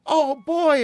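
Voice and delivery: put-on high, delighted oh boy; excited ad actor. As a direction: gush like a happy ad actor. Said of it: "It's a commercial".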